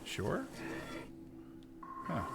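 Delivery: usual speaking voice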